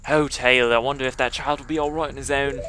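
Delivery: fretting in a put-on English accent. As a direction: wonder aloud with a mock English accent. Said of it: "I wanna do the English accent".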